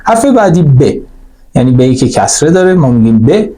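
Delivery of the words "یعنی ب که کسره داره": ordinary talk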